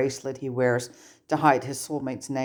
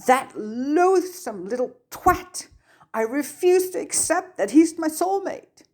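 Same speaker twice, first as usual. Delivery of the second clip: furious tearful outburst; 12 semitones higher than usual. A narrator's reading. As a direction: spit the insult out while still sobbing. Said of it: "heaving huge, angry sobs".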